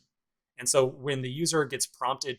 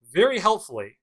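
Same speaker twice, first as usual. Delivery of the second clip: higher, louder mock praise; sarcastic; 7 semitones higher, 8 dB louder than usual. Real, not acted. Teacher mocking a search that finds nothing, sarcastic. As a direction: lean on it with pointed sarcasm. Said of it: "I say that entirely sarcastically".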